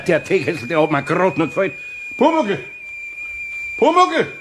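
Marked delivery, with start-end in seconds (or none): 2.2-4.3 calling out. Acted. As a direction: call him from the next room. Calling the kobold from another room. (laut nach dem Kobold rufend)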